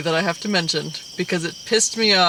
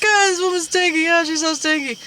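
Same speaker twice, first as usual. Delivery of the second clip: mock disgusted bystander; 9 semitones higher than usual. Mocking the smelly wife.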